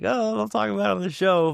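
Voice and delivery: high-pitched fretting imitation; mock worry. Relatives wary of being talked about on the podcast, mock worry.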